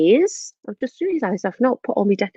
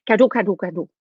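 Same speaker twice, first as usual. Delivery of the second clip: "cadeau" rattled off without gaps; quick-fire repeats; faster than usual. Shown as a demonstration. Teacher challenging children to say presents, quick-fire repeats. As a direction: say "cadeau" as fast as you can, over and over. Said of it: "How fast can you say it?".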